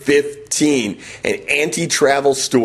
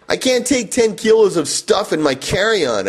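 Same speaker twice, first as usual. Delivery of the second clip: raised, exasperated protest; an exclaimed objection, pitched up; exclaiming; 7 semitones higher than usual. Retold or imitated. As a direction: throw the words up in disbelief. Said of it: "I exclaimed".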